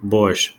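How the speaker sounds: "борщ" echoed in Russian as "borshch", one short syllable; careful Russian repeat. Shown as a demonstration. Learner echoing the reader, saying "борщ" carefully. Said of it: "make sure that you're getting close to the pronunciation".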